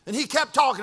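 ordinary talk, nothing marked

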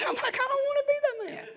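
very high, small comic voice; mock scared squeal. Preacher acting his dread of being singled out, mock scared squeal. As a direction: squeak it out in hammed-up reluctance.

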